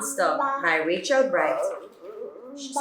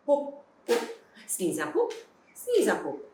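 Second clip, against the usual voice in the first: plain reading voice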